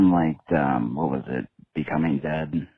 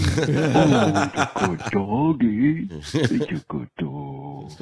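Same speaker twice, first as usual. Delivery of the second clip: very high, slow cooing; baby talk to a dog; 10 semitones higher, 3 dB louder, much slower than usual. Acted. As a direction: stretch it out, cooing at a puppy. Talking to the dog Piper.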